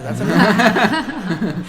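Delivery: a laughing slow punchline; laughing, drawn out. Shown as a demonstration. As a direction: stretch it out while cracking up. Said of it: "That's what that is".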